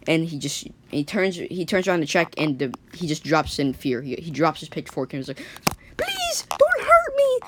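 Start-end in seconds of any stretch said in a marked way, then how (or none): 6.0-7.4 terrified whisper. Acted. Father begs in fear.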